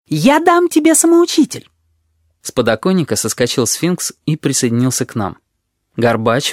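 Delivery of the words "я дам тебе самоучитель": very high, squeaky character voice; high-pitched, pushy little figure; insistent offer. A narrator's reading. Tabaki keeps insisting, very high voice.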